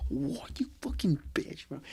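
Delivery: high, soft, disbelieving mock outburst; incredulous retort. Vlogger reacting to Gabi's rudeness, high soft disbelieving retort. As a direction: fire back in stunned disbelief under the breath. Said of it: "I'm like".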